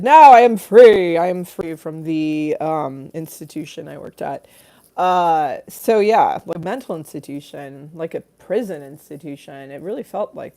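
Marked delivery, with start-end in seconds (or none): 0.0-4.5 slow, loud, declaiming read; grand mock proclamation of freedom; reading a comment out slowly. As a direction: proclaim it like a liberation. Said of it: "Chuck said".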